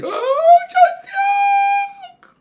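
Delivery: very high, loud drawn-out cry; imitating shrieked prayer; mock prayer scream. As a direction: scream the name in mock fervour. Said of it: "On crie".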